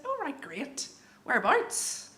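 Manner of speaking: high, polite reply rising into a question; polite probing. Retold or imitated. Trying to place a stranger from home, politely probing.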